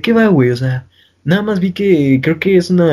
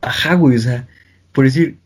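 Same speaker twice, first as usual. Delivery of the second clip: usual speaking voice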